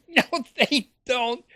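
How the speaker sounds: high laughing sarcastic no; laughing scoff. Acted. To viewers about poor people's money, high laughing scoff. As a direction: laugh it off, mocking the claim.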